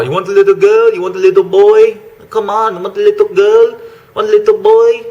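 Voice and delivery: high, loud mock Cambodian accent of a street pimp; shitty Cambodian accent. Vlogger imitating a Cambodian pimp offering kids, in a bad Cambodian accent. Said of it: "That's a shitty Cambodian accent".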